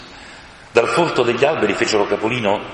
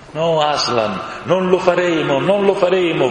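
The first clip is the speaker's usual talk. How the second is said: shouted together; 8 semitones higher than usual. A narrator's reading. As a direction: cry out a promise all at once. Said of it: "gridarono all'unisono". (gridato all'unisono, più acuto e forte)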